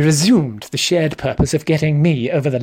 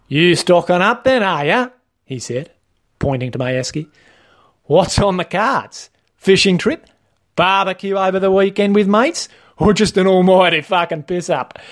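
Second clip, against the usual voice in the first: jocular liquor-store staffer; 4 semitones higher than usual. Acted. Staff member joshing a customer about his esky, jocular. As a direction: voice an over-familiar store man.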